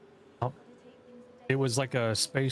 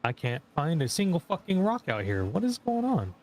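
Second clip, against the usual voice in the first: frustrated; 6 semitones higher than usual. Real, not acted. Frustrated mining, pitch jumps.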